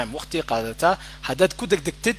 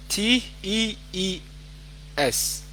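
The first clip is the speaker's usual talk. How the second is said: slow "T E E S", letter by letter; spelling out the mistake; 3 semitones higher, 5 dB quieter, slower than usual. Shown as a demonstration. Teacher shows student's wrong spelling, slowly spelt "T E E S". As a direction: spell "T E E S" with a gap before S. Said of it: "That is wrong".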